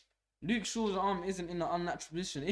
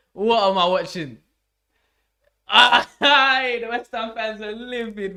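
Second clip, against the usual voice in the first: loud laughing amazement; real giddy yell; excited shout; 6 semitones higher, 14 dB louder, slower than usual. Real, not acted. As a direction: shout it in delight. After the save, still roaring.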